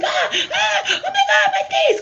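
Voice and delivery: high, loud laughing shriek at own face; shocked shriek. Retold or imitated. Astral traveler shrieking at her own tiny body. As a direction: scream it out in amazed disbelief.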